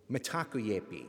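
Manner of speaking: Mitakuyepi set apart, syllable by syllable; staccato. Shown as a demonstration. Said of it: "Second word's a little more complicated".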